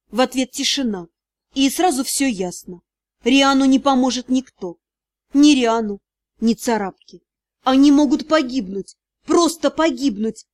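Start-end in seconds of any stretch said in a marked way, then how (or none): none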